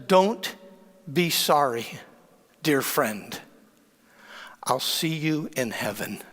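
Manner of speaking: slow, hushed, pausing between phrases; softening and lowering toward the end; tender farewell. Retold or imitated. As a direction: gently comfort a grieving friend. Interpreter relays friend's farewell.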